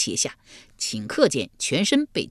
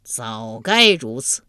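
stern King Yama; 4 semitones lower, 4 dB louder, slower than usual. Acted. King Yama strokes beard.

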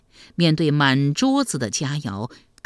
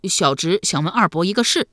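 put-on louder boyish voice; eager young nephew; 4 dB louder than usual. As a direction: speak up like an artless lad.

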